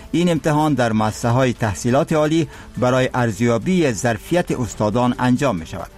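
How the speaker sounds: measured formal news read; solemn formal newsreader. Acted. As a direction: read the headlines with gravity. News bulletin read by anchor.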